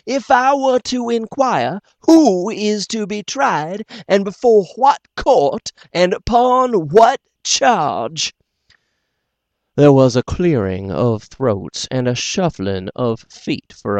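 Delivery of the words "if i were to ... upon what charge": higher, louder, slow mock courtesy; very polite. The doctor pressing the court, slow and icily polite. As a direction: ask each question with icy courtesy. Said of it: "very polite".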